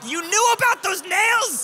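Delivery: very high, loud acted shriek; comic high-pitched shout of outrage; outraged yell. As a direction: scream the accusation, hammed up. Acted outraged reply after injury.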